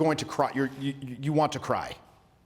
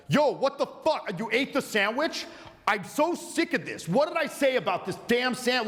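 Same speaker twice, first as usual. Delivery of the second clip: high-pitched, exasperated rant without real volume; mock angry yelling; 9 semitones higher than usual. Confronting a coworker who ate your sandwich, mock angry yelling. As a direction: act out an outraged squabble, voice pitched way up. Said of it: "you start yelling at 'em".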